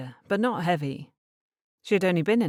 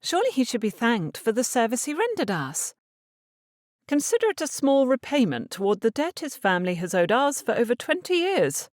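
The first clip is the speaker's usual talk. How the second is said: a hint of a young lady, pitched higher and pleading; lightly voiced Eliza; 8 semitones higher, 3 dB louder than usual. A narrator's reading. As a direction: voice the daughter gently higher, earnest.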